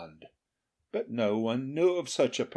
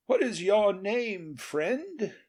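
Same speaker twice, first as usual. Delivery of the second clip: lightly voiced king, raised and curious; king's questioning voice; 9 semitones higher than usual. A narrator's reading. King asks the charcoal burner his name. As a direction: ask the stranger kindly from the storm. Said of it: "inquired Charlemagne".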